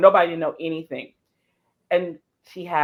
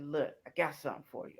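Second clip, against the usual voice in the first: soft, low, quick; hushed secret; 3 semitones lower, 14 dB quieter, faster than usual. Retold or imitated. Handing Gail the hidden wig, soft and quick. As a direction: share a secret.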